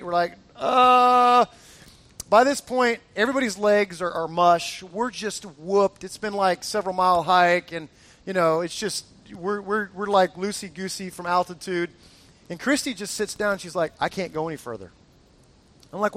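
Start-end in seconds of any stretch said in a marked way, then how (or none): 0.5-1.5 long, loud groan of dismay; drawn-out mock exhausted groan; weary groan. Tired hikers hearing the guide say it's easy, groaning. 14.0-14.9 low, soft, given-up voice; mildly defeated. Retold or imitated. Wife to husband as she sits down, defeated.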